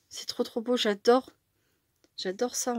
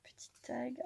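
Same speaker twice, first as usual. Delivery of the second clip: a soft whispered note to herself; 12 dB quieter than usual. Real, not acted. Noting the little tag in a whisper. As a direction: say it without voice.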